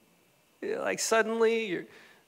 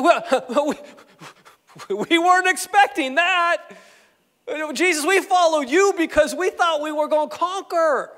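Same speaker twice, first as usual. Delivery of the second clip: high, loud protest of disbelief; hammed-up stunned complaint; stunned disciples; 7 semitones higher, 5 dB louder than usual. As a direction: act out shocked followers objecting. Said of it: "They, they were stunned".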